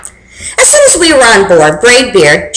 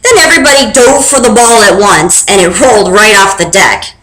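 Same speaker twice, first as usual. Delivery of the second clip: ordinary delivery, nothing marked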